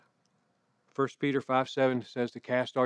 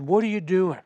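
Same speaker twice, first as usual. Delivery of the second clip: higher-pitched exasperated dad yell, slightly raised; retold yell; 5 semitones higher, 3 dB louder than usual. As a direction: imitate a dad calling out to his kid. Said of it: "finally, I yelled out".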